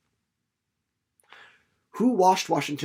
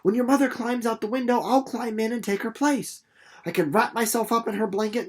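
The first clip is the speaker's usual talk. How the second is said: put-on higher, quick excited girl's voice; a girl rushing out her plan; high eager young Winnie; 5 semitones higher than usual.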